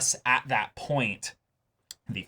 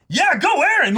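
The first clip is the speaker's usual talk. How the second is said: high, loud mock cheer; cheering humans; 7 dB louder, faster than usual. Humans on Paradis cheering Eren, excited shout. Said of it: "are like".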